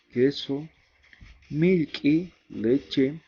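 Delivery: clear, measured drill of Aymara vocabulary; pronunciation drill. Shown as a demonstration. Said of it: "vamos a repasar lo que es la pronunciación". (repaso de pronunciación, en voz alta)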